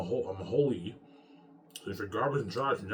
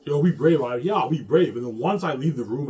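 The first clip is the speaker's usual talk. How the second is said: shouting; 5 semitones higher, 12 dB louder than usual. Real, not acted. Urging himself, shouting.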